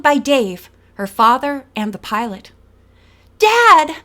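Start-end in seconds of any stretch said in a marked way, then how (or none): 3.4-4.0 much higher, louder cry of relief; exclaimed. A narrator's reading. Nancy crying out to her father in relief. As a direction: burst out with joy. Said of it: "Nancy exclaimed".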